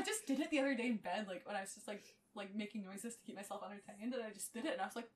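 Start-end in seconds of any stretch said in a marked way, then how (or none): none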